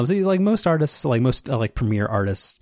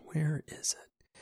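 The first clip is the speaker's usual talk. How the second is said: whispering; 6 semitones higher, 11 dB quieter, much slower than usual. Real, not acted. Searching for link, muttering.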